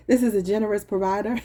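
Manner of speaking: usual speaking voice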